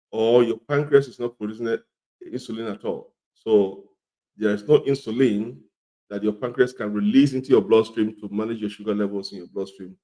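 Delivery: normal talking voice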